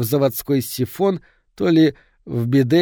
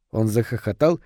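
ordinary delivery, nothing marked

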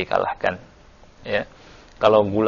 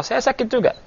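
much higher, retold Antara line; Antara admitting pain. Preacher retelling Antara answering his opponent, higher retold voice. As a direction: lift the voice high to play Antara calmly admitting his pain. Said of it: "Kata Antara".

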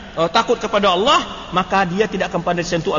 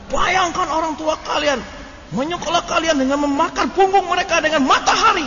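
campfire indoctrinator; 7 semitones higher than usual. Retold or imitated. Tutor telling students to picture their parents' toil, mock-dramatic pleading. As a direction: imitate a guilt-tripping tutor with mock pathos, pitched high. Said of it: "luar biasa doktrinnya kan".

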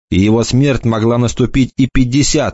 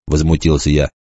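plain reading voice

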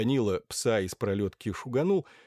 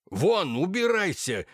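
angry shout; 8 semitones higher, 4 dB louder, slower than usual. Acted. Lackey chasing the dog away.

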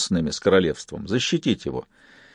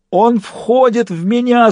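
slow, high howl; drawn-out, hammed-up cry; wailing; 12 semitones higher, 4 dB louder, slower than usual. A narrator's reading. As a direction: wail as if being possessed. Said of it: "завыл паяц".

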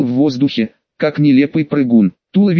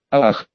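usual speaking voice

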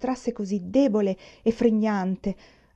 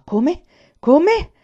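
rising, louder outraged disbelief; startled outburst; 9 semitones higher, 11 dB louder than usual. A narrator's reading. Father startled by his son's defiance, rising outraged disbelief. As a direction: jump at the words, building. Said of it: "Il padre sobbalzò".

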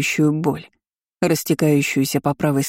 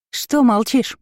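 higher, needling question; demanding cousin; 8 semitones higher, slower than usual. Acted. Kiora needles her silent cousin, high and demanding. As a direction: prod her for an answer.

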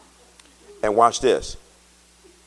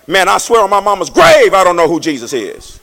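loud, high, sung swearing; Peter swearing; 10 semitones higher, 12 dB louder than usual. Retold or imitated. Peter to the bystanders, a loud sung denial. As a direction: belt the oath out. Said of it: "So this time he like".

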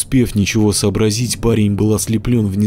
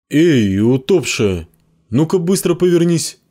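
calling out; 8 semitones higher than usual. A narrator's reading. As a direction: call out sharply. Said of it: "окликнул девочку Ярик". (окрик)

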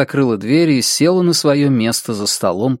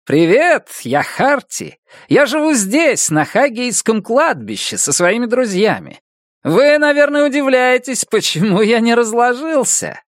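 put-on high, bouncy children's presenter voice; chirpy and upbeat, like kids' TV; cheerful kids' TV host; 10 semitones higher than usual. A narrator's reading. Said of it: "весёлым голосом ведущего детской телепрограммы".

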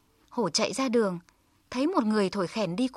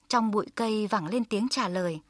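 ordinary delivery

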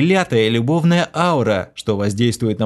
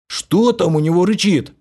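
eager question; 8 semitones higher than usual. A narrator's reading. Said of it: "жадно спросил".

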